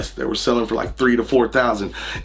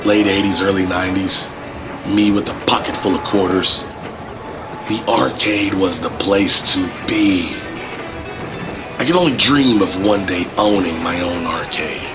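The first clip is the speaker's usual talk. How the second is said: nostalgic narration; 5 semitones lower than usual. Acted. Nostalgic intro over music.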